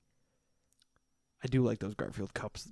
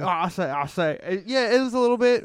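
high, loud southern rooster blowhard; Foghorn Leghorn; 8 semitones higher, 8 dB louder than usual. Retold or imitated. A nod to Foghorn Leghorn for a friend. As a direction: boom it out like the cartoon rooster. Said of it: "foghorn leghorn".

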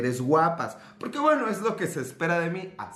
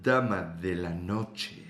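low, slow sultry purr; low sultry lady of the night; sensual; 9 semitones lower, 4 dB quieter, much slower than usual. Acted. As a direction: breathe it out seductively. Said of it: "así como sensual".

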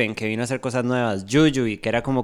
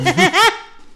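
high laugh; 6 dB louder than usual. Real, not acted. Laughs at the joke.

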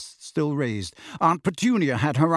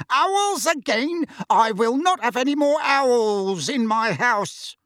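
high, slow furious ranting; 10 semitones higher, slower than usual. Acted. Uncle ranting about owls, high and slow fury. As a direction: rage at the owls.

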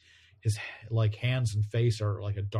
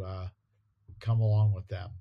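ordinary talk

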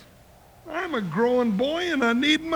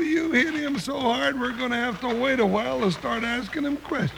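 ordinary delivery

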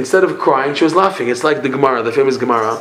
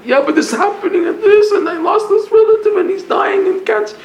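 high-pitched mock lament; complaining objector. Teacher mimicking someone upset over troubles, high mock whine.

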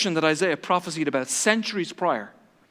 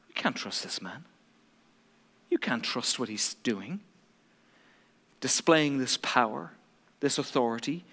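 much lower, hushed whisper; conspiratorial whisper; 7 semitones lower, 8 dB quieter than usual. Retold or imitated. Preacher voicing the plotting leaders, low whisper. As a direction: murmur it like a secret plot.

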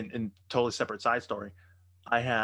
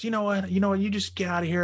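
high-pitched, indignant imitation of her; mock angry woman; 8 semitones higher, 5 dB louder than usual. Retold angry woman ordering him out.

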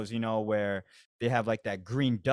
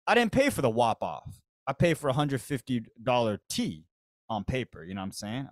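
ordinary talk, nothing marked

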